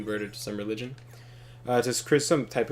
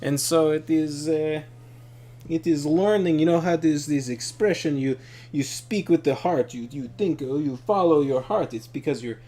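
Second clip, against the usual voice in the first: put-on higher, louder Russian-accented enthusiast; imitated Russian accent; 6 semitones higher, 4 dB louder than usual. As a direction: explain it earnestly in a mock Russian accent. Retelling her theory in her accent.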